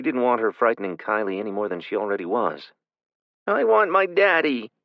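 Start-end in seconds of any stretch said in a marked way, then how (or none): 3.5-4.7 put-on high, tearful little-girl plea; crying child. A narrator's reading. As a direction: whimper for her daddy. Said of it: "Kylie cried".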